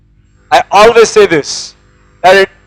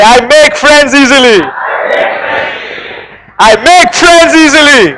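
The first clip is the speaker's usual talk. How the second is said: shouted affirmation; 4 semitones higher than usual. Shown as a demonstration. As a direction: belt it out to the whole hall. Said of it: "Shake the hand of your person".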